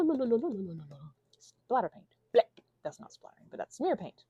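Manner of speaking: ordinary talk, nothing marked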